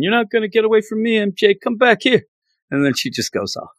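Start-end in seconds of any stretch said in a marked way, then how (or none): 0.0-2.2 high, fast mock shout after a fleeing friend; hammed-up chase call, pitched way up; Gwen yelling after her. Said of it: "And then you have Gwen".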